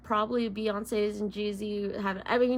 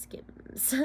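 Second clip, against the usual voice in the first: Kim Kardashian voice; 11 dB quieter than usual. Pretend. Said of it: "my Kim Kardashian voice".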